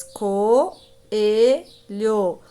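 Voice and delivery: co, e, lho split by clear pauses; "co... e... lho", each syllable held on its own. Shown as a demonstration. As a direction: sound out co, e, lho as three separate syllables. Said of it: "Separando as sílabas".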